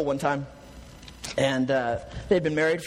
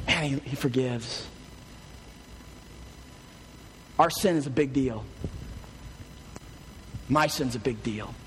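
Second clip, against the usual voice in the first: low, measured sermon delivery with long pauses; solemn preaching; 5 semitones lower, 3 dB quieter than usual. Real, not acted. Preacher assures congregation that Jesus forgives them, solemn and measured. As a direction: let each phrase land gravely.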